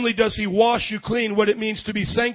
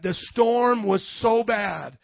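ordinary talk

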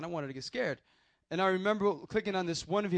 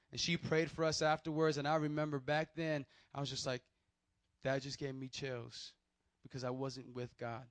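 ordinary talk, nothing marked

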